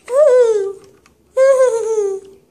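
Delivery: high, loud playful cheer; high woohoo. Pretend. Toy cheering in the pool, high loud woohoo. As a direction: cheer like a toy splashing around.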